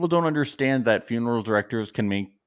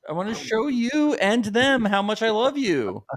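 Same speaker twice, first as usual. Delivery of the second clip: much higher, pleading mock of Tom; mock lovesick plea; 10 semitones higher than usual. Host mocks Tom pleading with Maria, higher lovesick whine.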